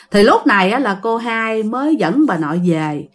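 ordinary delivery

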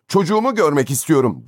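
high, desperate demand; almost shriek it in desperation; near scream. A narrator's reading. Father demands to see his child, near scream. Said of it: "neredeyse çığlık atarcasına".

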